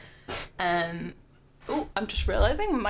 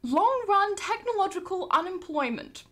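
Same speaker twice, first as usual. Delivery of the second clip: posh, higher reading voice; 11 semitones higher than usual. Shown as a demonstration. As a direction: read the quote like a posh toff. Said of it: "reading them in a posh voice".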